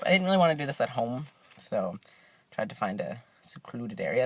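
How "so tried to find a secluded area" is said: soft, low, hushed aside; whispering. Real, not acted. Vlogger explaining the secluded parking spot, half whispering. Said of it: "I don't know why I'm whispering".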